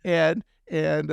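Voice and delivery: usual speaking voice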